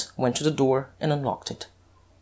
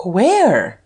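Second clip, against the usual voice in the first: higher, louder startled cry; astonished; 4 semitones higher, 5 dB louder than usual. A narrator's reading. Duke asking where Lupin is, astonished cry. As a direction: blurt it out in amazement. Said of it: "cried the astonished Duke".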